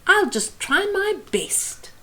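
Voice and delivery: a tiny nervous songbird; little cheep. A narrator's reading. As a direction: cheep it bravely, small. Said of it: "she cheeped".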